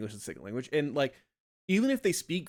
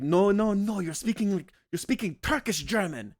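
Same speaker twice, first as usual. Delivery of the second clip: high, loud mock scolding German teacher; shrill-free, high loud imitation of a strict teacher scolding; teacher scolding a student's German, mock yelling; imitating teacher yelling; 9 semitones higher, 7 dB louder than usual. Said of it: "she would always yell at us".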